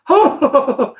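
high, exaggerated fake laugh; beaming sarcastic chuckle; mock gleeful laugh. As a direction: burst into a hammed-up happy laugh. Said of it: "Und dann so lachend".

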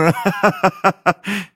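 high loud guffaw; hearty laugh. A narrator's reading. Peppi laughs at his own joke, loud.

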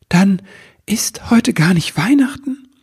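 high-pitched dawning realisation; startled elf's squeaky question; incredulous question. A narrator's reading. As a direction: ask in high, astonished disbelief. Elf realises his mistake.

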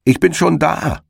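high triumphant call across the field. Acted. Hedgehog's wife calls out triumphantly to the hare. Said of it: "rief ihm der Herr Igel entgegen".

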